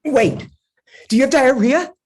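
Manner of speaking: sudden high alarmed question; panicked blurt. Acted.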